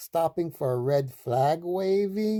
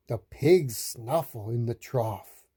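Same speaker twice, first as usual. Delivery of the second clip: ordinary delivery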